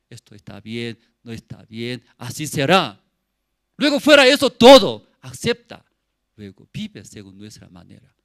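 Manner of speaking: ordinary delivery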